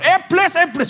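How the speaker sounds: high-pitched exorcist's command; Daud Tony casting out demons. Retold or imitated. Preacher imitating an exorcist driving out Satan, high commanding cry. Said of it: "kalau Pak Daud Tony kalau ngusir setan".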